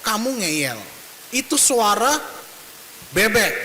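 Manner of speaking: mock stern father losing patience; angry father.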